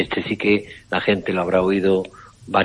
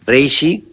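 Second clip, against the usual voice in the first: "Reishi" higher and louder, rising; emphatic name; 5 semitones higher, 4 dB louder than usual. Shown as a demonstration. Doctor announcing the supplement name to radio listeners, emphatic.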